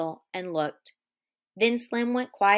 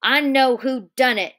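loud accusing cry, pitched up; cries out; 4 semitones higher, 8 dB louder than usual. Acted. Curly accusing the men in the barn, loud cry. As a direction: blurt it out as an accusation. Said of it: "he cried".

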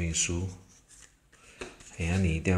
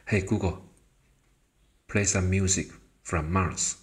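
normal talking voice

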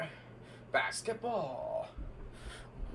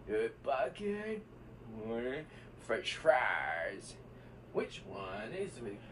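ordinary delivery

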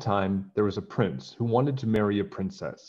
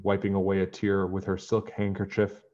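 usual speaking voice

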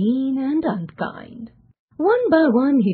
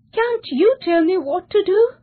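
high, louder plea; imploring, pitched-up question; begging; 10 semitones higher, 5 dB louder than usual. A narrator's reading. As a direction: beg for guidance. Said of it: "begged John".

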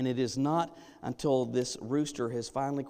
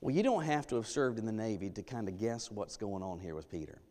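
usual speaking voice

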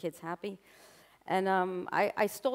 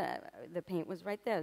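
normal talking voice

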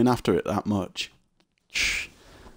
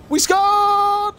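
yelling in triumph, much higher; excited shout; 8 dB louder than usual. Real, not acted. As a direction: celebrate the goal at full voice. Celebrating a goal.

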